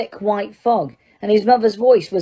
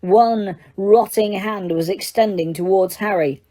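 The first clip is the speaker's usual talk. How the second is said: usual speaking voice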